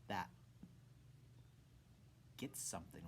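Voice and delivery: ordinary delivery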